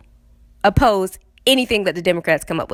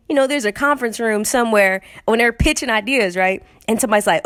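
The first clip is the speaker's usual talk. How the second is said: ordinary talk, nothing marked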